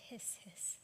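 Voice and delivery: ordinary delivery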